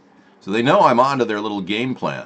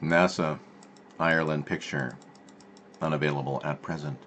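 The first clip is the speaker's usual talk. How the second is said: ordinary talk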